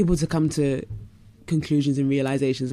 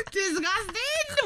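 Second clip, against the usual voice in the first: shrieking laugh then disgusting; high laughing; 4 dB quieter, much slower than usual. Real, not acted. Laughing at tweet.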